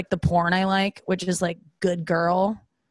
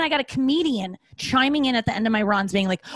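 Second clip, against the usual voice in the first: ordinary talk, nothing marked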